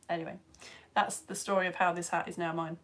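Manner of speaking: normal talking voice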